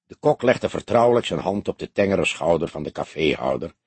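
plain reading voice

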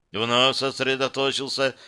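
plain reading voice

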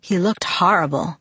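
ordinary delivery, nothing marked

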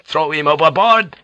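furious order. A narrator's reading. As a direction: order it in a fury.

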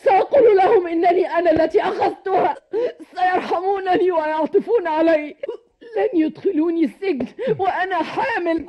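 tearful, broken pleading; crying. Acted. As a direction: sob through the words. Beaten wife promising, weeping. (بصوت باكٍ)

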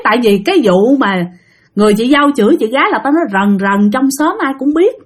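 ordinary delivery

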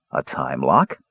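low echo rising into a question; puzzled question. A narrator's reading. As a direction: repeat it uncomprehendingly, starting low.

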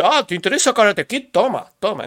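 mock Google pushing suggestions; Google's voice. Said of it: "Google dice".

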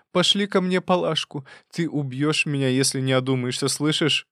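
a mother berating her daughter, aggrieved; scolding mother. A narrator's reading. Марья Дмитриевна продолжает упрёки. (мать упрекает дочь, с обидой, выше голосом)

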